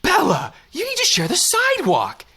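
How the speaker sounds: put-on high sing-song baby talk to a dog. Woman scolding her dog on the sidewalk, high baby talk. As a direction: coo at the dog like a toddler. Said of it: "she said to it".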